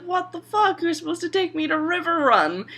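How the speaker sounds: imitating an affronted girl; indignant outburst. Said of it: "and Arya's like".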